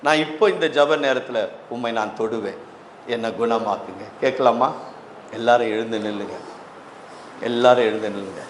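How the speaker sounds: low, subdued pastoral voice; prayerful appeal. Real, not acted. Pastor inviting congregation to touch the Lord for healing, prayerful. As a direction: bring them gently down into prayer.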